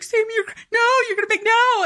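high, urgent mock scolding; imitating a friend's loud, high-pitched "no"; alarmed protest. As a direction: cut a friend off before the tears start, pitch way up. Friend stopping her from crying, acted.

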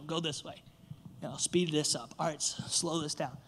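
low, soft nudging directions; hushed, low-key instructions; quiet imitated guidance. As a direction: steer gently, well under the voice. Voices gentle directions from God's reins.

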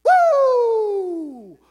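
loud, high hallway whoop; captain's woo. Retold or imitated. Pastor imitating the cheerful captain arriving each shift, loud high whoop. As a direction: whoop down the hall like the happy captain. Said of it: "and he goes".

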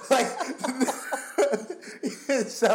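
laughing. Real, not acted. Laughing at dad story.

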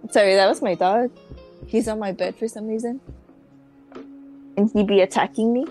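ordinary talk

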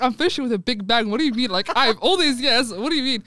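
loud, eager retold bragging; hyped-up plan, said loud; excited boast. Telling a friend about the party-popper plan, excited and loud. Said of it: "wallahi, I was like".

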